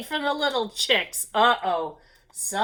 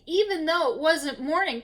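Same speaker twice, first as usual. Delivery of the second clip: plain reading voice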